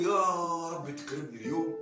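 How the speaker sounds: loud eager plea; hopeful fisherman. Acted. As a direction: beg the sea for a good catch. (رجاء الصياد المتحمس)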